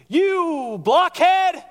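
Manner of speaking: slow, high, loud mock yell; yelled insult. Pastor imitating a driver yelling at him on the 805, hammed-up yell. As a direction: stretch out "You blockhead!" like a driver yelling from the car.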